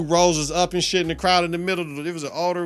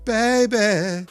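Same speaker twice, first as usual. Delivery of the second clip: swooning over concert; 5 semitones higher, much slower than usual. Retold or imitated. Reliving the concert, drawn out.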